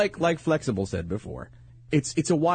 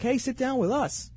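flirty mom invitation; 10 semitones higher than usual. Pretend. Acting flirty moms at coffee.

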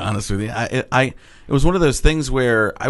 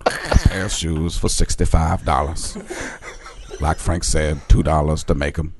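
Morgan Freeman impression; 5 semitones lower than usual. Morgan Freeman-style narration.

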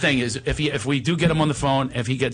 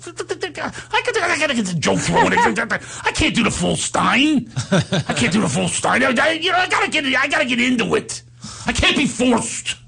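high, stammering, embarrassed impression; stretched-out high whine; mock reluctant squeal, tripping over words; Steiny; 9 semitones higher than usual. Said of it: "He's a little shy".

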